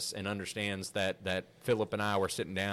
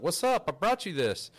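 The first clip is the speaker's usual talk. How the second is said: voicing the owl; 7 semitones higher, 3 dB louder than usual. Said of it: "He's like".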